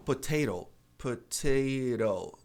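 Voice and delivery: "potato" with the last T as a tapped R; American flapped T. Shown as a demonstration. Teacher showing how Americans usually say it, flapped T. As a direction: keep the first T, tap the second in "potato". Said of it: "substituir o T pelo re".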